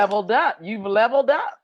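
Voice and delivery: loud and high, teasing; excited. Real, not acted. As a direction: cheer it out playfully. Teasing friend about wine.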